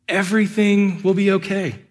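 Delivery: high pleading mock voice; mock whiny prayer. Pastor imitating believers begging God for money, high mock plea. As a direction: lightly send up a greedy prayer.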